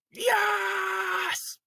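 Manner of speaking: high shouted yes; triumphant yes. Acted. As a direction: cheer like you just won. Triumphant cry.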